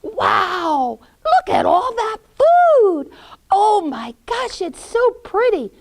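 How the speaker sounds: put-on high, loud boyish wonder; amazed Hansel. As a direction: marvel excitedly at the treats. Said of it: "He's looking in and he's going".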